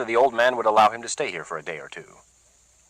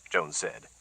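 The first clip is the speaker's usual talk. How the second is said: ordinary delivery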